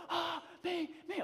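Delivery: high, excited little volunteer squeak; eager girls. Retold or imitated. Fourth graders volunteering as hype girl, high eager squeal. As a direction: imitate a kid begging to be picked.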